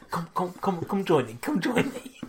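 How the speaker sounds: hushed, luring call with a laugh; quiet laughing beckon; soft beckoning. Retold or imitated. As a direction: coax them in softly. Beckoning the crowd softly.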